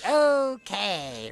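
high squeaky chipmunk voice. Retold or imitated. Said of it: "I can do a chipmunk voice".

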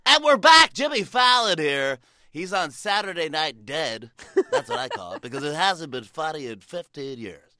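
brash DJ mocking his guest live; on-air DJ. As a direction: swing back on air to roast the guest. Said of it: "radio shows".

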